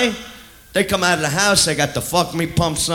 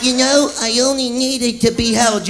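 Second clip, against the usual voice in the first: mock woman; 5 semitones higher than usual. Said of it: "they're like".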